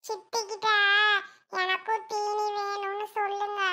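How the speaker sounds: put-on a little boy quietly asking his mother; soft, secret child voice; child's hushed request. Boy quietly urging his mother to ask for snacks.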